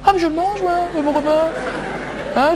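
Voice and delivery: absurdly defiant squeal; high-pitched outburst. Acted. Man loudly accepting his humiliation, high-pitched outburst. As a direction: jump into a loud, very high comic voice.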